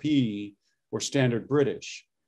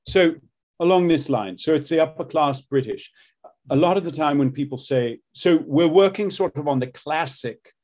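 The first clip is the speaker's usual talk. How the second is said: upper class British RP, a bit louder; classic RP accent; 3 semitones higher, 3 dB louder than usual. Shown as a demonstration. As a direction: demo the old posh RP. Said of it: "what does that accent sound like?".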